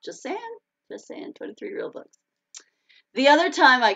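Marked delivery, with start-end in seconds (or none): 0.0-2.2 half-whispered, amused aside; hushed aside. Real, not acted. Author slipping in her count of books, hushed aside. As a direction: drop to a very soft, breath-only murmur.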